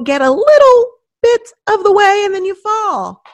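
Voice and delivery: emphatic shout of encouragement; rising exclaim. Real, not acted. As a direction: push it up like a coach calling out. Rousing a pep-talk point.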